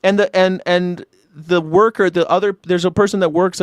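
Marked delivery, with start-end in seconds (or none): none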